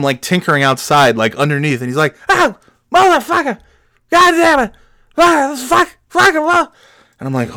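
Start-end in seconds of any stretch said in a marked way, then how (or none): 2.3-6.7 high-pitched bursts of swearing; frustrated cursing. Retold or imitated. Trucker tinkering under his truck, frustrated cursing. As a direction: act out a trucker losing it, curse by curse.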